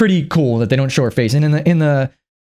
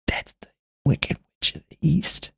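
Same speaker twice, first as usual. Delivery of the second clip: slow hushed whisper; whispered realisation; 2 semitones higher, 8 dB quieter, slower than usual. Retold or imitated. Realising in the cinema, a slow hushed whisper. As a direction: breathe it out like a secret discovery.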